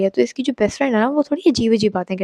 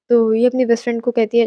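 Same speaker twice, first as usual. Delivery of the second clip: ordinary delivery, nothing marked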